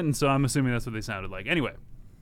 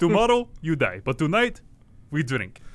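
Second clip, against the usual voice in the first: mock Russian toast. Host plays Russian kidnappers toasting their captive, mock accent. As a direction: growl it like a movie Russian, then a party twist. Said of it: "'cause I guess I'm Russian".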